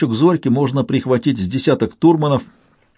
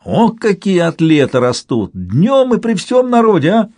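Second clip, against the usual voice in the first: high, gleeful amazement; exclaiming, mostly high-pitched, relishing the absurdity; delighted mock outrage; 8 semitones higher than usual. A narrator's reading. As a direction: marvel at it with theatrical delight. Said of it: "Самсон в восторге шлёпнул руками по бёдрам".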